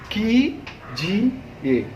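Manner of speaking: की जी ए drawn out slowly and softly; slow syllable demo. Shown as a demonstration. Teacher spelling की जी ए slowly for pupils. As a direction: stretch की, जी, ए. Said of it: "ध्यान से सुनिएगा".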